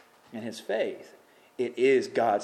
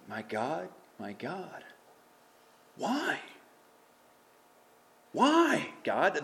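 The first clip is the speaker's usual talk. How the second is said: hushed to crying out; 4 semitones higher, much slower than usual. Acted. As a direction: start in a hush and break into a plea. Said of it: "He's asking".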